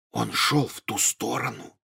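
slow, barely voiced whisper; hushed whisper. A narrator's reading. Harry to friends so Hagrid won't hear, hushed whisper. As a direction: whisper it as quietly as you can. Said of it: "как можно тише прошептал Гарри".

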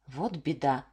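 low, sighed regret; sighing. A narrator's reading. Bearded peasant sighs over the hungry folk. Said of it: "вздохнул мужичок".

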